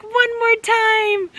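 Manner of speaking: high, slow, bright "Wasn't that fun?"; excited cheer. Retold or imitated. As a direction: cheer a scared kid on after the ride. Mother urging another ride.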